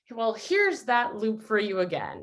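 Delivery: normal talking voice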